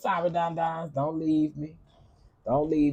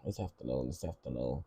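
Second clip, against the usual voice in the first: low mock voice; 13 semitones lower, 12 dB quieter, faster than usual. Self-answering in low voice.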